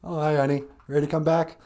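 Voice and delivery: deep put-on voice, easygoing; acted partner on a call. Acted call between a couple.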